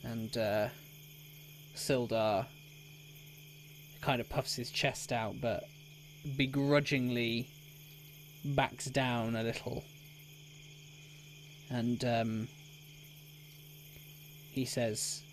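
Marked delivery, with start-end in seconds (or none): none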